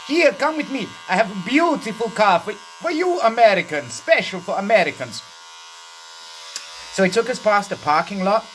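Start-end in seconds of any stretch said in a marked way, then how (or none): 0.1-5.2 high, pushy salesman voice; hammed-up dealer showing off a car; car rental guy. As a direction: lure them to a special car. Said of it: "So then he said".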